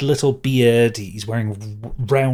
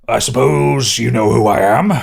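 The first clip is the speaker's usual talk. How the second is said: loud, puffed-up official; self-important magistrate; 8 dB louder than usual. Acted. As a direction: announce yourself with great ego. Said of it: "ego about him".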